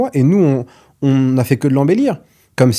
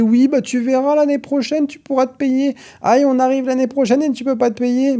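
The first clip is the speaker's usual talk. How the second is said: mock accountant; 11 semitones higher than usual. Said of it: "puis il te dit".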